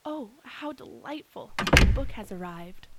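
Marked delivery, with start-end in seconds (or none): 0.0-1.5 surprised delight. Acted. Muriel hearing the parcel arrive.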